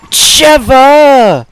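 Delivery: high, loud exasperated shout; angry yell. Real, not acted. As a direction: yell at her in frustration. Partner blunders, angry yell.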